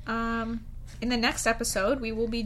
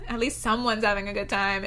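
ordinary talk

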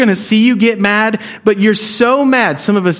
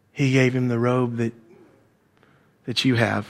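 hushed servant's report; 7 semitones lower, 7 dB quieter than usual. Acted. Servant tells the older son the news.

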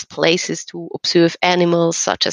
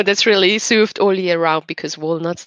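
normal talking voice

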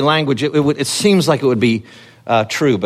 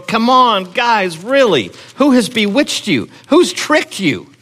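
higher, exasperated scolding, slowing on the questions; chastising; 8 semitones higher, slower than usual. Retold or imitated. Preacher voices Paul scolding the tricked Galatians, exasperated and higher. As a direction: throw up your hands at the foolish church. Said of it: "he's chastising them".

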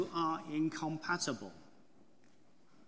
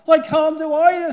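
very loud, high storybook wail; desperate wail; 10 semitones higher, 19 dB louder than usual. A narrator's reading. Pooh wedged in the hole, wailing in desperation. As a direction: cry out as the trapped Pooh.

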